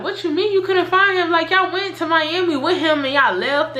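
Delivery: high, sharp, indignant retold outburst; demanding an answer, outraged; upset. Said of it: "now I'm getting upset".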